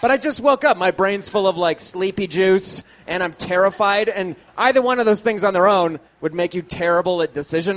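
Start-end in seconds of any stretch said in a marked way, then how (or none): none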